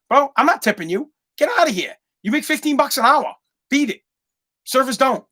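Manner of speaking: mock brush-off. Dismissing Dunkin' Donuts worker.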